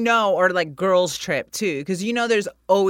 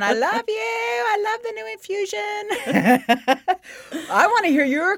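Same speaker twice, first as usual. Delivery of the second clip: high, gushing love note; 14 semitones higher than usual. Acted. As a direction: swoon over the brand. Gushing at vodka sponsor.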